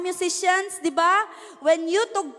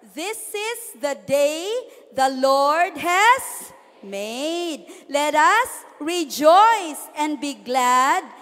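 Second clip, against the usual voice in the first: slow, measured scripture read; a slow, solemn Bible reading; much slower than usual. Acted. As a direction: read the verse slowly, with reverence. Reads Psalm verse.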